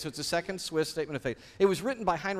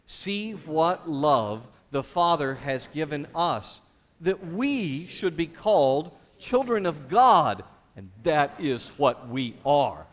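slow scripture reading; 4 dB louder, slower than usual. Acted. Congregation reads scripture together.

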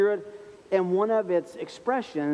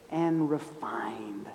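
whispered ending; 10 semitones lower, 7 dB quieter, much slower than usual. Real, not acted. Quote trails off softly.

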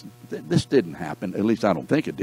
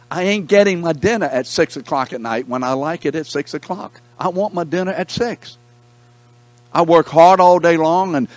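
higher-pitched, louder indignant griping; mock-indignant husband, raised voice; frustrated rant; 8 semitones higher, 4 dB louder than usual. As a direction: play the aggrieved husband ranting about supper.

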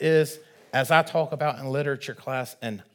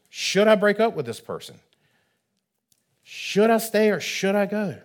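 higher, quick voicing of a pleading believer; imagined pleading prayer; 7 semitones higher than usual. Preacher voices a believer praying for a clear answer, higher and pleading. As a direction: play the desperate asker begging for a yes or no.

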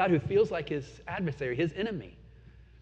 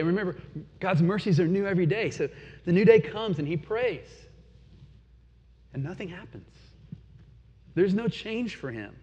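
ordinary delivery

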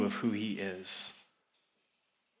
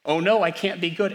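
imitating a nervous churchgoer, higher and louder; anxious worry; 6 semitones higher, 7 dB louder than usual. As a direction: play the worried listener fretting. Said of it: "you kinda tense up and you say".

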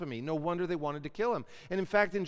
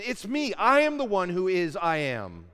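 It's me, I am the one, all said loud and pressed; emphatic; 2 semitones higher, 7 dB louder than usual. Shown as a demonstration. Teacher voicing God's emphatic name, loud stress. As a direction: hammer home who is I am. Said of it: "you're emphasizing".

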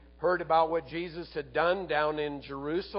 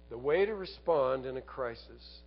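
ordinary delivery

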